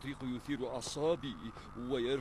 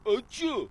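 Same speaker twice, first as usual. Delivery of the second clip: sharp loud sneeze; 7 semitones higher, 8 dB louder than usual. Acted. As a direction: sneeze it out hard. Sneeze interrupts his report.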